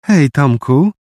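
put-on high, sweet cooing girl. A narrator's reading. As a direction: coo it sweetly. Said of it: "zagruchał słodki głos". (słodkie gruchanie)

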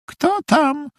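put-on tiny, very high girl's voice; quiet girlish voice. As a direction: ask in a small, high girlish voice. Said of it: "zapytał cichy dziewczęcy głosik". (cichy dziewczęcy głosik)

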